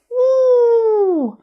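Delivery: high wolf howl; one high howl. Shown as a demonstration. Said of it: "A wolf says".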